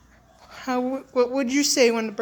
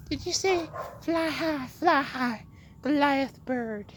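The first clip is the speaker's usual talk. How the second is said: voicing the dog; 3 semitones higher, 3 dB quieter than usual.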